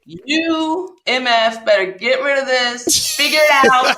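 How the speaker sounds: loud, hammed-up furious tirade, slow; furious rant. Woman retelling how she raged at her boyfriend, loud mock fury. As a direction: rail at him, half laughing at yourself. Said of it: "I was beside myself".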